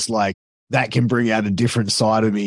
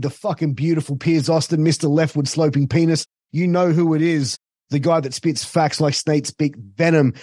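over-the-top wrestling promo brag, pitched up; hyped self-promo; 5 semitones higher than usual. Acted. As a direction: sell yourself like a heel cutting a promo.

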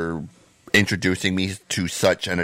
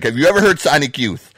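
challenging retort; 5 semitones higher, 5 dB louder, faster than usual. Retold or imitated. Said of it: "And I was like".

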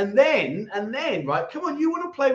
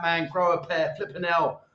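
ordinary talk, nothing marked

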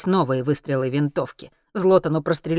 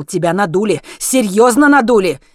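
loud, rising to higher pitch; heated insistence; 5 semitones higher, 10 dB louder than usual. A narrator's reading. Zlotan tells Henry he was duped, heated and loud. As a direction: drive it home more forcefully the second time.

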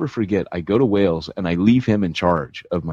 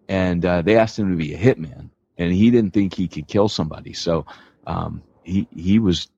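usual speaking voice

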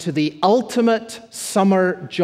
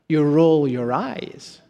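normal talking voice

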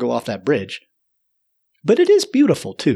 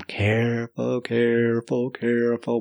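soft, drawn-out singsong steps; careful stepping; 4 dB quieter, slower than usual. Retold or imitated. Storyteller inching out on the ice bridge, soft careful chant. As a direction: murmur each one softly, like tiptoeing.

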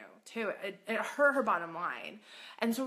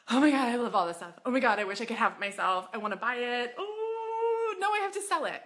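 excited gush; 3 semitones higher, 4 dB louder than usual. Pretend. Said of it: "and you're like".

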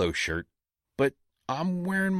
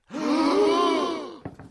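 loud high gasp; shocked gasp; 3 dB louder than usual. Acted. Crowd reacts to latte order.

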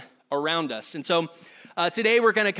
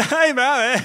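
put-on high, loud blubbering child sob; mock ugly kid cry; 7 semitones higher, 7 dB louder than usual. Kid bawls to mom, hammed-up ugly cry. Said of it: "the ugly kid cry".

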